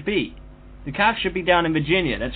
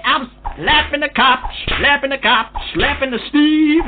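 loud, high, drawn-out gloating; mock rampage; 6 semitones higher, 5 dB louder than usual. Threatening the cop in play, loud and high with relish. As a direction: holler it like blow-by-blow action.

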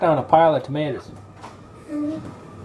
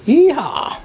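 excited whoop; 13 semitones higher, 6 dB louder than usual. Real, not acted. Cheering meatballs into the oven.

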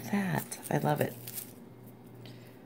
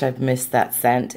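ordinary delivery

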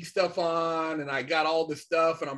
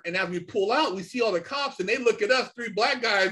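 ordinary delivery

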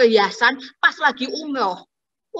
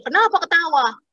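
imitated annoyance, pitched up; annoyed taxi driver; 7 semitones higher than usual. Taxi driver challenges his laughing passengers, mock annoyed. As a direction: demand an answer in a mildly angry, higher voice. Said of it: "Terus bapak taksinya marah".

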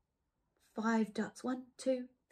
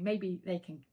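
usual speaking voice

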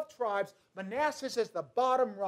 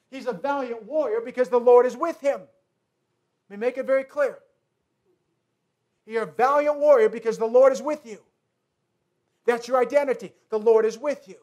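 full-force preaching; 7 dB louder than usual. Acted. Preacher driving home identity.

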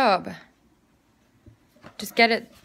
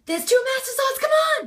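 very high, insistent begging; urgent pleading. Acted. Begging a reluctant friend over two master swords, urgent pleading. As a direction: push it up high, pleading hard.

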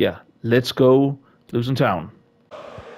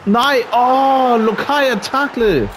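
dismayed shout; 10 semitones higher, 3 dB louder, much slower than usual. Real, not acted. His opponent scores against him.

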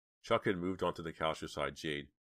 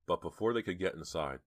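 plain reading voice

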